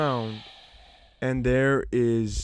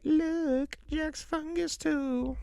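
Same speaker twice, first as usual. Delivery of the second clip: put-on high, slow taunting kid voice; much higher, slow schoolyard taunt; mocking kids; 4 dB quieter, much slower than usual. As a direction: imitate the kids teasing. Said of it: "everybody would be like".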